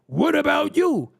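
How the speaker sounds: high, slow mock whine of a critic; mock-whiny what about you. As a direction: whine it back at them like a deflector. Mocking people who deflect.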